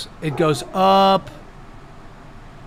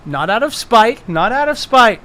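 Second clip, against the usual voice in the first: higher, protesting repeat; mock insistence; 4 semitones higher, 5 dB louder than usual. Game master justifying his spell, mock insistence. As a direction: protest your innocence too eagerly.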